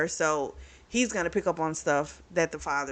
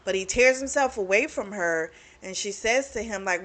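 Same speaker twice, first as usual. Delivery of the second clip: usual speaking voice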